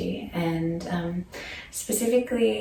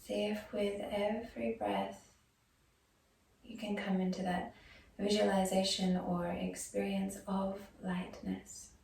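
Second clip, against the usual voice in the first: softer yoga nidra voice with long pauses; guided meditation; 10 dB quieter than usual. Acted. Yoga teacher guiding students into breath and body awareness, soft meditation voice. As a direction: ease them gently into stillness, leaving space after each phrase.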